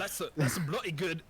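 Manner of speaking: put-on a British accent that slips in instead of Scottish. The DM voices the dwarf's praise of the ale, slipping into a British accent. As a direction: say bloody good in a British accent. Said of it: "oh, that's British".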